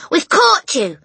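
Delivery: high, loud triumphant yell. A narrator's reading. Matilda confronts the burglar, yelling. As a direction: shout it at a cornered intruder. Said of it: "she yelled".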